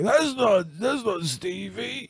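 high, soft, slow mock realisation; imitated startled friend. Teasing a friend who walked in on him, high hushed mock realisation. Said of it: "and you're like".

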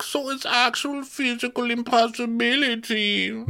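high, accented impression of a haughty denial; hammed-up accented protest; Diana. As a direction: put on her foreign accent, pitched high. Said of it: "And she's like".

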